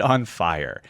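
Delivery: usual speaking voice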